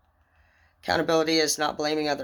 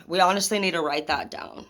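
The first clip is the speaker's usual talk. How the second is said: ordinary talk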